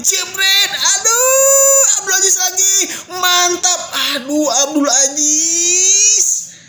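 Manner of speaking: high, crying-out commentator yell; excited shout. Real, not acted. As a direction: cry out at the near miss. Near miss, commentator outburst.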